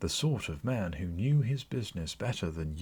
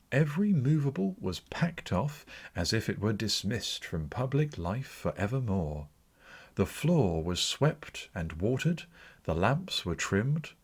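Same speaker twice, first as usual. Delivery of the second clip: plain reading voice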